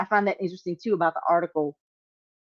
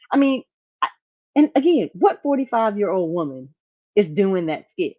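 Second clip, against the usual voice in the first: usual speaking voice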